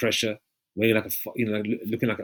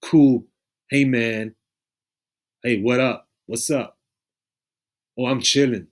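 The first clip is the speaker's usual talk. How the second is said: mock movie hip talk; 3 dB louder, slower than usual. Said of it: "they even put this in movies".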